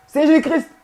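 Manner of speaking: high mock-devout invocation, a little laugh; imitating the faithful. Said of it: "vous le dites comme ça".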